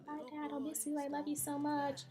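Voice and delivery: high, sing-out voice of a daughter; mock daughter's farewell. Daughter saying bye to Dad, high mock farewell. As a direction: send Dad off in a high put-on voice.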